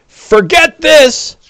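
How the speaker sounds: high, slow, strained orc voice; panicked goblin-like squeal as he flees; fleeing orc. Acted. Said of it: "he says in Dr-, in, in Orcish".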